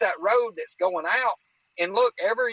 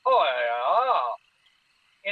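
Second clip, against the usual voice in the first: imitating creature chatter; much slower than usual. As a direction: bounce the syllables up and down like a strange creature. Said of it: "the other one would pick back up and go".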